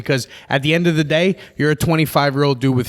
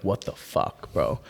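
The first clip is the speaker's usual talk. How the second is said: softer, lower, under-the-breath amazement; hushed disbelief; 6 semitones lower, 9 dB quieter, much slower than usual. Acted. Fan reacting to a rapper's verses, hushed disbelief. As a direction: say it quietly, as if stunned by a verse.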